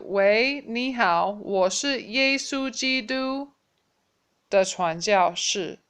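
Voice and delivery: slow and careful, words stretched; slow demo. Shown as a demonstration. Teacher offering the shorter greeting, slow and clear. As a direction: lay out the short version slowly. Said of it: "Or you can change it to".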